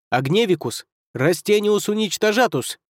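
rising shouted magic words; spellcasting incantation. Acted. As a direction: cast it with full force. Gremlin casts spells.

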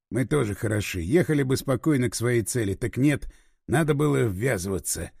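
low, self-reproachful grumble; grumbling. A narrator's reading. Lev complains while chasing the minibus, grumbling. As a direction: grumble to yourself, pitched well down. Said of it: "Лев ворчал".

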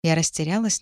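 ordinary delivery, nothing marked

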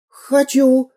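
put-on high, excited cry of a child; high excited shout; eager exclamation. A narrator's reading. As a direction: blurt out with joy. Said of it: "воскликнул Веня".

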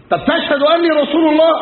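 high-pitched pressing question; insistent interrogator. Acted. (سؤال ملح بنبرة عالية)

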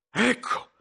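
ordinary delivery, nothing marked

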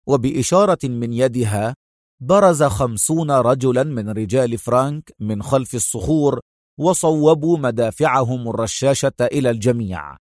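plain reading voice

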